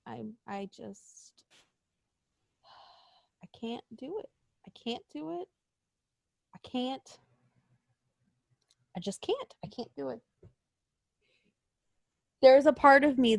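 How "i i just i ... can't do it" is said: defeated mutter. Real, not acted. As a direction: barely say it, giving up. Exasperated despair, very soft.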